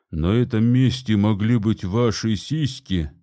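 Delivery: lightly voiced infomercial announcer; a hint of a blaring TV ad proclaiming; booming ad voice. A narrator's reading. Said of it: "провозгласил он трубным голосом из рекламы".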